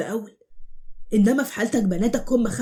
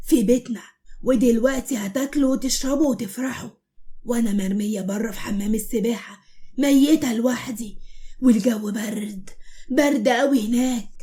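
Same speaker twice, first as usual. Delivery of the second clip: slow, hushed ghost voice drifting into a whisper; eerie ghost girl; slower than usual. Acted. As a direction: speak like a cold dead girl from the pool. Ghost girl speaks to guests.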